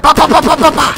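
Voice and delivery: loud, high rapid-fire bursts; gunfire noises. Acted. Player fires as a ninja, loud vocal gunfire. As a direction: rattle off shots with the mouth.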